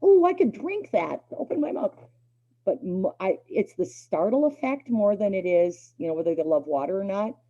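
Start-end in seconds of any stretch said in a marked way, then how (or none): 0.0-1.9 happy dog voice. Retold or imitated. Collie voiced happily drinking the water, high and loud. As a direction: voice a collie delighted by the squirt. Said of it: "They're like".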